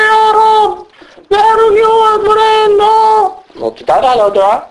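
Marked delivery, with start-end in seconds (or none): 0.0-3.3 much higher hammed-up wail of dismay; mock lamenting wife. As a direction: play the distraught wife, pitched way up. Wife lamenting husband's deed.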